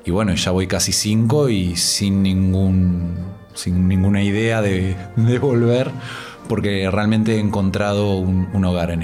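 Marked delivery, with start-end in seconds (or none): none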